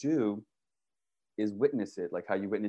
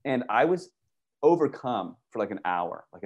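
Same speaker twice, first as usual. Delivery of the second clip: ordinary delivery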